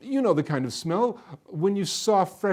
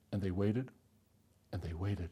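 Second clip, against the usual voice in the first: hushed, lower, fading with each repeat; fading suspense; 7 semitones lower, 11 dB quieter than usual. A narrator's reading. Storyteller drawing out the villagers' wait, hushed. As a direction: let the wait trail into near silence. Waiting drags on, fading away.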